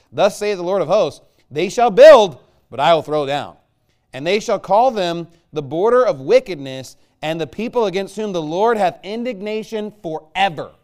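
loud, ringing scripture reading; preaching scripture at full force. Acted. Preacher reads God's judgment on Edom to his church, loud. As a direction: proclaim the verses like a judgment.

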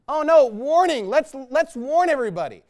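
loud, high sarcastic warning; mock alarm. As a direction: feign panic to ridicule the warning. Mocks movie warning over a word.

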